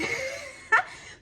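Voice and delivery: high burst of laughter; real laugh. Real, not acted. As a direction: laugh at your own failed attempt. Laughs at failing hand demonstration.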